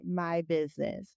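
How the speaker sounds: normal talking voice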